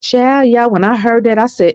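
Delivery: normal talking voice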